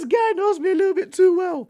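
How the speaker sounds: high-pitched, laughing amusement; amused disbelief. Real, not acted. Vlogger reacting to being known too well, tickled and high-pitched. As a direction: squeal it through a rising laugh.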